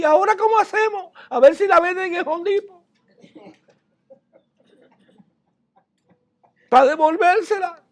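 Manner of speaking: mock the panicked borrower who lost the axe; mocking worried man. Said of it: "Cualquier otro dice". (voz burlona mucho más aguda de un hombre angustiado)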